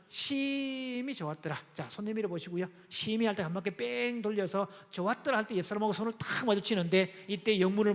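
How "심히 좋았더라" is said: 심히 drawn way out on a held note; stress on 심히. Shown as a demonstration. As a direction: stretch the first syllable of 심히 long before 좋았더라. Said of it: "그냥 좋은 게 아니라".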